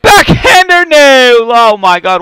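high, loud cry of dismay at the miss; loud, high gamer outburst at a blown chance; excited shout. Real, not acted. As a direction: yell it like the puck just got stopped. Near goal in hockey game.

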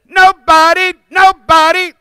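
Nobody twice, high and loud; double shout. Shown as a demonstration. Cueing the crowd's part, shouted twice. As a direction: call it out two times. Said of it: "do it twice".

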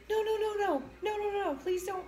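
high frantic no no no; scared pleading. Retold or imitated. Pleading with charging chihuahuas, high and scared. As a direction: plead with the little dogs to stay back. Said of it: "I was like".

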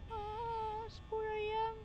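high excited cry of relief. Real, not acted. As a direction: yelp it out with relief.

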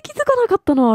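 very high, squeaky exclamation; high-pitched disbelief. Real, not acted. Gamer baffled at a missed clue, high-pitched disbelief. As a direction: let the voice jump way up in disbelief. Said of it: "もう高音になるわ".

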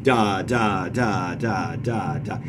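every "Da" hit with equal heavy weight; plodding DA, DA, DA with no weak beat; all syllables stressed. Shown as a demonstration. Teacher showing an all-spondee line, each "da" equally stressed. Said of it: "a line out of all spondies".

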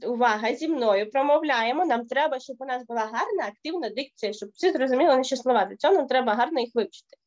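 usual speaking voice

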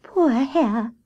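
high, tender sigh of sympathy; sighing pity. A narrator's reading. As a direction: sympathetic little rabbit voice. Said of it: "sighed Grey Rabbit".

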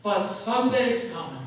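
loud, high congregational answer; crowd's yell. Retold or imitated. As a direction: answer back as one, loud. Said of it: "yelled with one accord".

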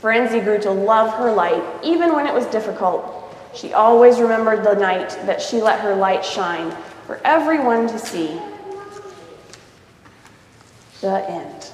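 lively read-aloud storybook voice; story narration. A narrator's reading. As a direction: read it to a child, voicing each character. Reads picture book aloud.

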